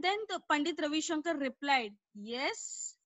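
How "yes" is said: soft and low, kindly asking; kind, questioning. Acted. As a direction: gently invite her to speak. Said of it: "asked questioningly but kindly".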